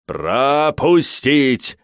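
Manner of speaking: booming, slow yell; thunderous bellow. A narrator's reading. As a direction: stretch the order into a long roar. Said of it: "громовым голосом орёт Мурат".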